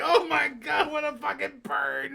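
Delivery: high, loud, delighted disbelief; amazed reaction. Retold or imitated. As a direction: gush like you just saw a killer burn. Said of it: "I was like".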